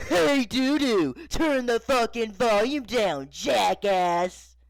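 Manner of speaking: loud, slow, high-pitched dad shout; irate father bellowing an insult; angry yell. Acted. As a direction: holler each word at full volume. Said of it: "and yelling".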